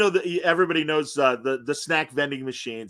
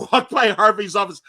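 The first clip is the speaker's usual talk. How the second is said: ordinary talk, nothing marked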